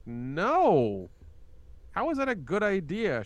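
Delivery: mock-outraged, pitch climbing on the question; retold incredulous objection. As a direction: replay an exasperated protest to a parent. Retold argument with mom, incredulous.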